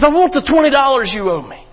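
high, loud angry demand. Acted. The forgiven slave demanding money from a debtor, angry. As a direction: bark it at the man who owes you. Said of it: "He says".

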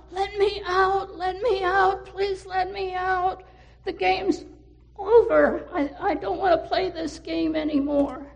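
put-on high, loud, frantic child's cry to be let out; panicked plea. Trapped girl begging from inside the washer, panicked and loud. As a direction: pound on the door and beg.

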